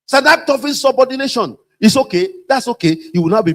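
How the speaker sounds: hammed-up boss scolding; mock official reprimand; mock stern boss. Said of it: "I'm quoting them".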